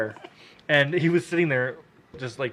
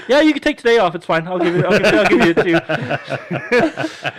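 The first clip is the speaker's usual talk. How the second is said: mock cheerful boss, much higher and louder; high sing-song boss; 6 semitones higher, 8 dB louder than usual. Boss giving permission on the phone.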